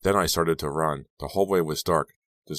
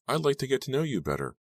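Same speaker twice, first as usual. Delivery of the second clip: nervous; 9 semitones higher than usual. A narrator's reading. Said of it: "a nervous little smirk".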